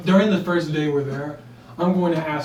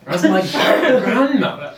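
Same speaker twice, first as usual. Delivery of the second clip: laughing; 4 semitones higher, 5 dB louder than usual. Real, not acted. Shocked at grandmother disguise.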